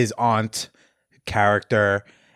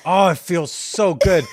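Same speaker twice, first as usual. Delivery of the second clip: slow, higher mock-taunting gloat; 5 semitones higher, slower than usual. Friend taunting the watching aunt, hammed-up and slow. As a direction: draw it out to taunt her, hammed up. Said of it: "taunting her".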